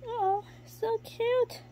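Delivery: very high, slow gush. Real, not acted. Gushing over her baby gecko, very high and slow. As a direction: melt over the tiny gecko.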